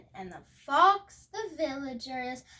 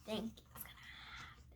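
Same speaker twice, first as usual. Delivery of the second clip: whisper; 4 semitones lower, 19 dB quieter, much faster than usual. Real, not acted. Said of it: "whispered aside to listener".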